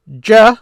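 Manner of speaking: "Ja" sounded out alone, high and loud; Arabic syllable demo. Shown as a demonstration. Teacher sounds out "Ja" for the students, crisp and loud. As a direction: call out "Ja" as one clear syllable. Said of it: "You notice these are moving".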